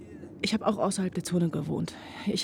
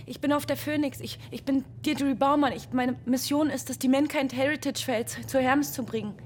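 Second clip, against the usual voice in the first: disoriented; 4 semitones higher than usual. Acted. Waking disoriented from a dream.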